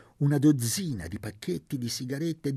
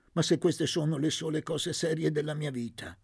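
ordinary delivery, nothing marked

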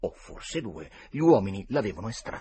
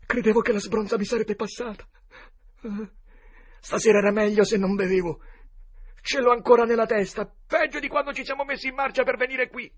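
higher, louder, stammering and bewildered; confused hungover Potter; 8 dB louder than usual. A narrator's reading. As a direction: plead with Joe, lost and scared. Confused drunk Potter pleads. (Potter confuso e spaventato supplica Joe)

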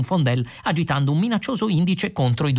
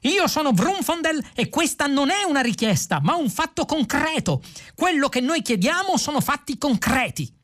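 high, strained ranting; excitable indignant protester; shrieked declaration; 12 semitones higher than usual. A narrator's reading. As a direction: rant it at a very high pitch. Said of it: "strillò Vrumfondel".

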